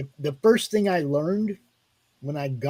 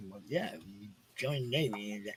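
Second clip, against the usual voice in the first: meek reply; 10 semitones lower, 17 dB quieter than usual. Retold or imitated. Recruits answering meekly.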